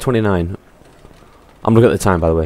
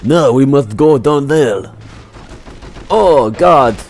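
hammed-up Russian with swooping higher pitch; mock Russian accent; 3 semitones higher than usual. Gamer narrating his play as a Soviet fighter, mock Russian accent. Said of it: "I shall talk Russian".

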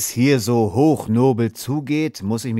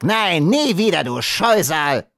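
much higher, louder screeching dwarf; 9 semitones higher, 5 dB louder than usual. A narrator's reading. The dwarf screams insults at Red Fox, much higher. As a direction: spit it out in a high shrill rage.

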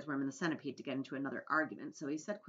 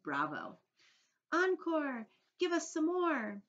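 high, loud calls for an encore; excited cheering shout; 11 semitones higher, 4 dB louder, slower than usual. A narrator's reading. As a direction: cheer like a thrilled audience member. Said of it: "stood up and shouted".

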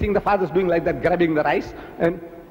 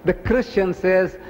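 ordinary talk, nothing marked

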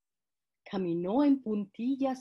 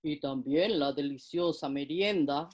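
wolf voice; 6 semitones lower than usual. Acted. Wolf keeps scheming.

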